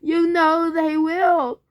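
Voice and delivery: high, loud, slow tearful plea; sobbing mother. A narrator's reading. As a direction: cry it out in despair. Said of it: "sobbed Missus Fox".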